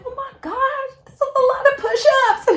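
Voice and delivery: high, squeaky, half-laughing panicked voice; inner panic. Retold or imitated. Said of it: "But in my head, I was like".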